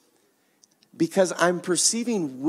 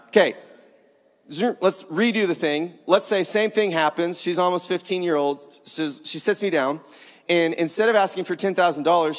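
normal talking voice

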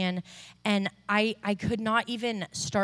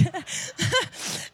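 high unvoiced giggle; breathy high laugh; 9 semitones higher than usual. Real, not acted. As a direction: let a delighted laugh slip. Laugh during retold healing.

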